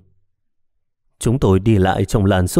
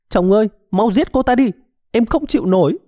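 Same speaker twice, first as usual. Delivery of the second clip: much higher, wailing cry to her husband; desperate scream; 12 semitones higher than usual. A narrator's reading. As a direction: beg him at the top of her voice. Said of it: "cô ta gào lên". (gào lên thảm thiết, giọng cao vút)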